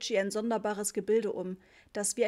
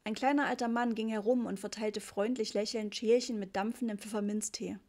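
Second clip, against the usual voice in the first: ordinary delivery, nothing marked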